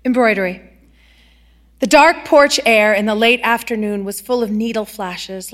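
ordinary delivery, nothing marked

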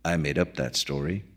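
quiet and low. Acted. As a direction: say it softly, almost to himself. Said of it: "said McDunn quietly".

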